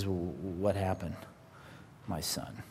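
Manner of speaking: slow, hushed, hesitant question; trepidation. Acted. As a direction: ask it softly, dreading the answer. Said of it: "with trepidation in his voice".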